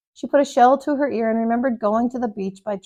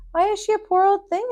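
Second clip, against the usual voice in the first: put-on high, curious child's question; little boy voice; 10 semitones higher than usual. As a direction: ask it like a small boy.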